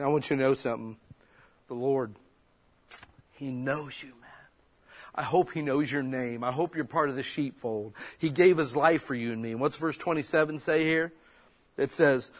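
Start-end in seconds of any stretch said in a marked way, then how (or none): none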